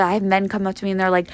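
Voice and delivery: usual speaking voice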